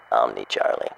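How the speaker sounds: low amused chuckle through the words; laughing. Real, not acted. Breaks up repeating the phrase.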